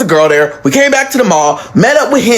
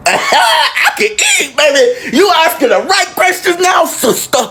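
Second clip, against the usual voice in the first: high, drawn-out, laughing eagerness; gleeful eagerness; 10 semitones higher than usual. Retold or imitated. Answering her sister's offer of food, gleefully eager. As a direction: squeal it with comic delight.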